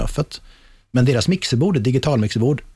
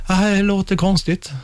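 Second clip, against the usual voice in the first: slow and higher, a hint of a bewildered sound engineer; puzzled visiting tech; 7 semitones higher than usual. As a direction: sound mildly baffled. Visiting tech admits problem. (lätt förbryllad ljudtekniker)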